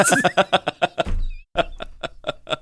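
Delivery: airy unvoiced burst of laughter; breathless laugh. Real, not acted. Father laughing at his daughter's swear, airy laugh. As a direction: crack up silently.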